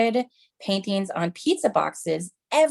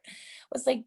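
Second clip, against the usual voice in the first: whisper; 6 semitones higher, 10 dB quieter than usual. Real, not acted.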